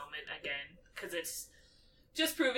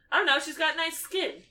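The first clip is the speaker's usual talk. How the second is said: higher, louder mock Ron; imitating Ron, flustered; 10 semitones higher, 5 dB louder than usual. Ron fumbling to explain a crush, higher and louder. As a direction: play the boy fumbling for words. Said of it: "he's like".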